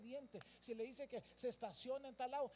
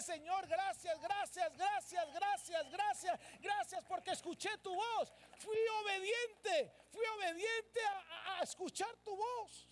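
high, impassioned shouted prayer; exultant cry of thanks; 8 semitones higher, 6 dB louder, slower than usual. Acted. Believer thanking the Lord for hearing his voice, exultant. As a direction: repeat it with mounting fervour, stretching the last phrases.

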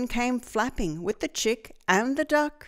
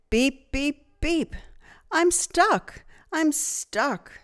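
stuck truck beeping; 7 semitones higher, slower than usual. A narrator's reading. Blue calls out to the farm animals, worried. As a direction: beep for help, sounding stuck. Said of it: "cried the little blue truck".

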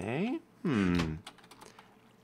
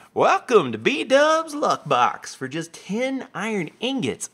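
high, drawn-out showman's welcome; slow, high, hammy sales pitch; showy announcer read; 10 semitones higher, slower than usual. Acted. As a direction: read it out like a grand game host. Reading a sign like an announcer.

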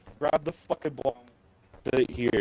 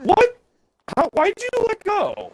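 shocked shout; 3 dB louder than usual. Real, not acted. Partner drops box, shocked.

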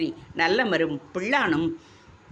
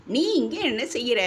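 higher, startled question; stunned, higher-pitched disbelief; shocked; 7 semitones higher than usual. A narrator's reading. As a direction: blurt it out in shock at seeing her. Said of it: "நுழைந்தவன் அதிர்ந்து போய் நின்றான்".

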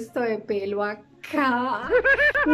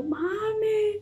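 high, wailing cry of mami; imitated crying call; 11 semitones higher than usual. Calling her mom from the salon, crying mami. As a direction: call mom like you're in tears. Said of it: "llamar a mi mamá llorando".

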